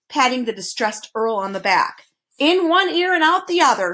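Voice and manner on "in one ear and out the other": high scolding duke voice. A narrator's reading. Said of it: "scolded the duke".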